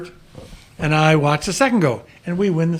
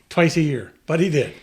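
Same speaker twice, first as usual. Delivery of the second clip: usual speaking voice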